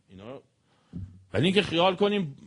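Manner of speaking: ordinary delivery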